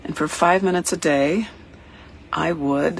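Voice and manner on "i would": ordinary talk, nothing marked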